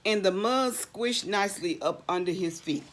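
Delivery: usual speaking voice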